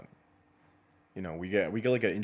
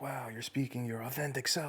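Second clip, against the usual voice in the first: higher-pitched mock gushing fan; gushing supporters; 6 semitones higher than usual. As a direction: imitate an awestruck supporter in a raised pitch. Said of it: "supporters that'll be like".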